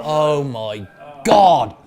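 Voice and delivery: loud, very slow "oh my God"; drawn-out exclamation. Real, not acted. Guest recalling a toilet mishap with mic on, exclaiming. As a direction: stretch each word in loud dismay. Exclaims over embarrassing memory.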